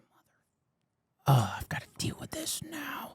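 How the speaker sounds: breathy mock groan under the breath; whispered exasperation. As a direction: hiss a private complaint, retold. Retelling passengers' dread at crying baby.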